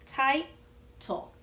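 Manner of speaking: "Title" said slowly as "Ti-tle", two syllables split apart; teacher splitting the word "Title" into syllables for the class; broken into syllables. Shown as a demonstration. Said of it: "Let's break the word title together".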